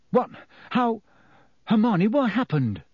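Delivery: high, startled questions; stunned and confused; shocked. Acted. Harry shocked after time jump.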